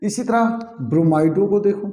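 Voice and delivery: ordinary talk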